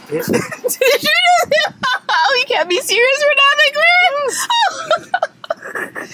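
high, laughing squeal; helpless laughter. Real, not acted. Teasing an emotional friend at a birthday surprise, helplessly laughing. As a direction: squeeze the words out through real giggles.

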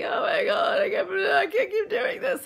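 high, half-laughing, half-crying wail; mock a breakdown, voice cracking; despairing cry. Retelling her old frustration, crying out half-laughing. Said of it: "I was just like".

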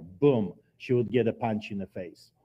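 ordinary talk

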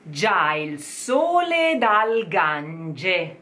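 Già il sole dal Gange read very slowly; slow diction demo. Shown as a demonstration. Coach modelling the full phrase for singers, very slow. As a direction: stretch each Italian vowel for them to copy. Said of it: "You try".

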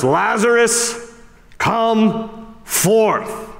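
high, slow call into the tomb. Retold or imitated. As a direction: call his name out to the grave.

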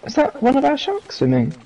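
high-pitched, startled questioning; gasp, then ask in high surprise; startled wondering. Real, not acted. Spotting what may be one of their sharks, startled.

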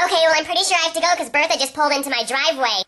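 ordinary talk

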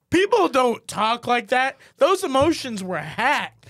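loud, slow, emphatic mock critique; scathing critique. Retelling Sean's complaint about the emotional scenes, loud slow scorn. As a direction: imitate a friend's verdict, hammering each word slowly. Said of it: "It's how he said it".

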